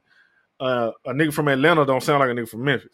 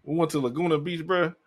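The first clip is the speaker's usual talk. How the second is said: ordinary talk, nothing marked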